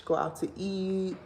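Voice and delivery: normal talking voice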